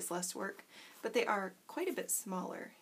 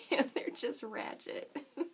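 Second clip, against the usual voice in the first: laughing; 10 semitones higher than usual. Real, not acted. Laughing at her messy nails.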